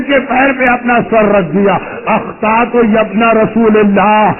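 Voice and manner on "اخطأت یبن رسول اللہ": slow plea. Acted. Hur begs forgiveness.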